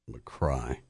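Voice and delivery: McCry said to rhyme with "cry"; McCry with a long i sound; pronunciation demo. Shown as a demonstration. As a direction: say McCry quietly, second syllable like "cry". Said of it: "I think it's pronounced".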